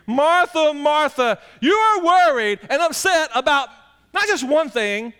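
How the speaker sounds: loud, high, stressed calling of the name Martha; emphatic repetition. Shown as a demonstration. Jesus gently correcting Martha, emphatic calling. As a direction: lean hard on each Martha. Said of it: "That's always emphasis right here".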